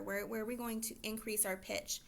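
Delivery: ordinary delivery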